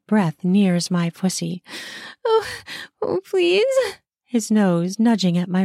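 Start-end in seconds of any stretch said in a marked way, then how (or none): 2.2-3.6 high, pleading moan; breathy pleading. A narrator's reading. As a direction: beg with pleasure. Pleading in pleasure.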